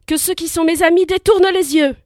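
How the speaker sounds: high, loud warning cry; shouting. Acted. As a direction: cry out a command to his friends. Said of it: "Alors Persée cria".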